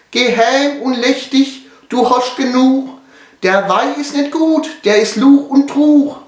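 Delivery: very high, eerie monotone chant; luring spirit voice. Acted. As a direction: draw out each line on one high pitch. (hohe, lockende Geisterstimme)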